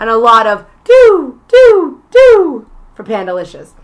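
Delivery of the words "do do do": loud scatting. Pretend. Imitating the game's music hits.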